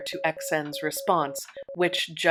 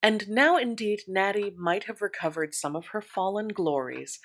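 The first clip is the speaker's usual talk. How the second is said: ordinary delivery, nothing marked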